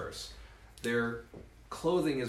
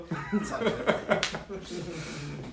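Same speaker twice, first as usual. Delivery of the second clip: rolling laugh; real laughter; 6 semitones higher, 3 dB louder than usual. Real, not acted. Speaker with the audience, laughing in bursts.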